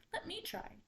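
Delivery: high, small character voice; little water spider. A narrator's reading. Spider volunteering to the council, high little voice. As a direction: pipe up as a tiny spider.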